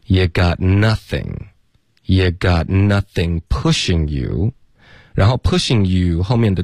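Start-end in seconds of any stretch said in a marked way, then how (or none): 0.0-1.3 "You got nothing" slowly, "You" reduced to "ye"; weak form, linked. Shown as a demonstration. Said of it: "弱读成 ye". 2.0-4.5 slow demo of "You got nothing pushing you" with "You" as "ye"; weak form, linked. Shown as a demonstration. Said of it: "弱读成 ye".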